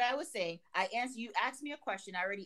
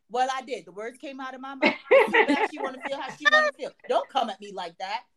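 wife snapping back at her husband, sassy; defiant sass; 2 semitones higher, 7 dB louder than usual. Retold or imitated. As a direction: laugh it off, unbothered and defiant.

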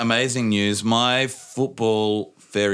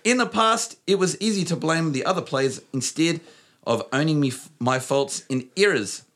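put-on New Zealand accent; 6 semitones higher than usual. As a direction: keep the Kiwi accent going. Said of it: "don't at me about the, uh, accent".

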